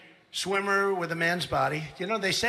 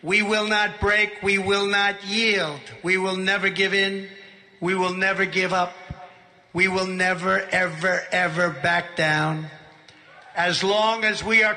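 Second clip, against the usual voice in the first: roaring rallying speech; 3 dB louder than usual. Acted. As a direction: punch each line to the crowd. Rally speech climax, crowd.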